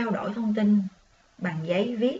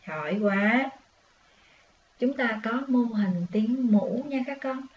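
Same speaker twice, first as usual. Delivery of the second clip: ordinary delivery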